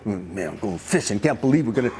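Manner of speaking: very low, muffled griping; private grumbling. Acted. Peter secretly resenting the fishing trip, low private grumbling. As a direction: grumble it softly to yourself. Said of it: "Felt one way inside".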